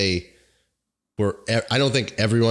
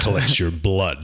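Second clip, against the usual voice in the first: Optimus Prime voice. Retold or imitated. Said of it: "Optimus Prime".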